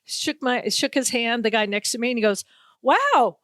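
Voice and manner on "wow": raised pitch, drawn-out ending on the compliment; mock amazement. Media man meets a TV host in person, amazed. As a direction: imitate someone blurting an astonished compliment. Man's tactless amazement, acted out.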